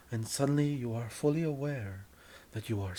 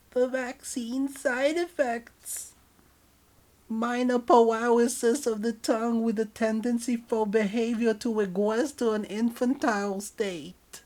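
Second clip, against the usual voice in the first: put-on very high, louder babyish voice; 12 semitones higher, 8 dB louder than usual. Partner explains vaccine side effects in baby voice. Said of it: "acting like a baby".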